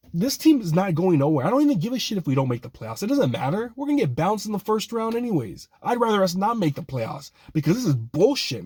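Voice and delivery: high-pitched, exasperated sports-fan tirade; fed-up fan venting at the team; angry rant. Real, not acted. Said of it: "getting angry here".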